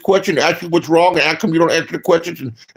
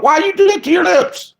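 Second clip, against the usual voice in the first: high disbelieving squeal; much higher, appalled question; incredulous; 11 semitones higher than usual. Real, not acted. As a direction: ask in shocked disbelief. Mocking Kim Kardashian.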